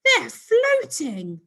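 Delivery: high, very slow astonished cry; amazed cry. A narrator's reading. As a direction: gasp it out in wonder. Ricky watches aliens float.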